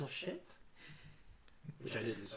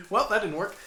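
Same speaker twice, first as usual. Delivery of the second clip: put-on thick Scottish accent; 6 semitones higher, 7 dB louder than usual. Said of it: "I got more Scottish".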